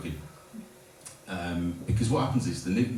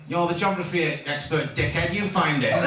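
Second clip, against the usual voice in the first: mock Manchester accent, higher and cheeky; Mancunian chav accent; 8 semitones higher than usual. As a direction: rude Manchester lad brushing you off. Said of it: "Fucking Mancunian chavin".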